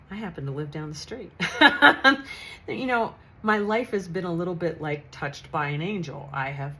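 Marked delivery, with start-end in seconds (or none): 1.4-2.6 bursting into a high, loud laugh. Real, not acted.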